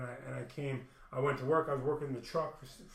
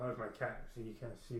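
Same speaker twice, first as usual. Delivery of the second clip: usual speaking voice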